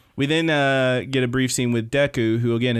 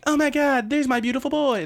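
high squealing fangirl voice; mock fangirl; 14 semitones higher than usual. Retelling Discount Harley Quinn gushing over Deku, high and squealing. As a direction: gush it like an obsessed fan. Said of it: "Discount Harley Quinn was like".

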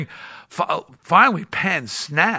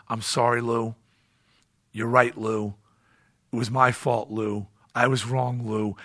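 much softer, lower soothing apology; calming apology; 6 semitones lower, 6 dB quieter than usual. Retold or imitated. Penn placating furious Lou beside him, soft soothing apology. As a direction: gently talk a raging giant down. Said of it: "he's just trying to calm the situation down".

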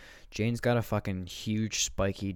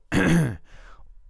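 throat clear; 10 semitones higher, 9 dB louder than usual. Acted. Doctor preparing bad news.

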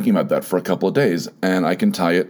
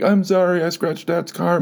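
put-on high, tearful little-kid voice, much higher; a scared kid sobbing out a confession; crying child's apology; 11 semitones higher than usual. Boy crying to his mom about scratching dad's car, high and tearful. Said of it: "I ran to her and started crying".

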